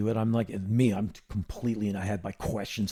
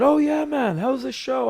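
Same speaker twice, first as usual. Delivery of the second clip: [name] impression; 12 semitones higher, 6 dB louder than usual. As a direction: play [name] greeting him, pitched up. [name] voiced in retold chat.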